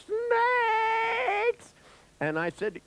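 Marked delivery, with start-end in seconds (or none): 0.0-1.7 very high, drawn-out shriek; long comic wail of terror; imitated terrified scream. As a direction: act out a panicked patient screaming. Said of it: "the guy looks at me and he goes".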